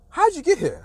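very high-pitched, baffled disbelief; mock incredulous question. Coaches to the uninvited walk-on, high-pitched disbelief. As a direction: imitate someone astonished at an intruder.

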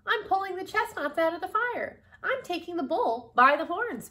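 high, energetic storybook declaration; rousing determination. Acted. Miss Tilly busy saving the day, fired up. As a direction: declare each saying in a high, rising rush of resolve. Tilly triumphant while churning.

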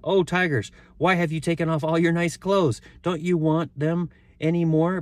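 high, loud storybook call. A narrator's reading. Boy calling to the far-off tigers, high loud call. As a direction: call up and out to them. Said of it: "jumped up and called out".

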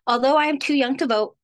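normal talking voice

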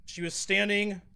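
ordinary talk

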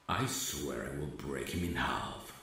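plain reading voice

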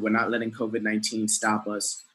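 ordinary delivery

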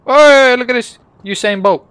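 loud, high call-out; high-pitched, loud burst of excitement; excited shout. Real, not acted. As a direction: shout it out to show off. Calling out excitedly while swimming in game.

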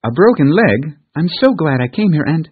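put-on a girl taken aback, then chattering; surprised little girl. Pollyanna reacts to injury.

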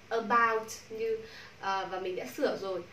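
ordinary talk, nothing marked

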